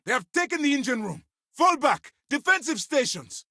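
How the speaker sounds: high, strained shout to the crew; frantic shouted orders in combat; urgent battle orders. Acted. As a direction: yell commands as the ship is overrun.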